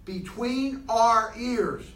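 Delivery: slow, stretched and pressed; slow emphasis. Real, not acted. Speaker to the group, slow emphatic point. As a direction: draw out each word to drive it home.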